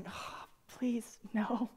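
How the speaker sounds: hushed, mock-dreading whisper; whispered plea. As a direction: plead under your breath, barely voiced. Dreading the friends' plan, retold.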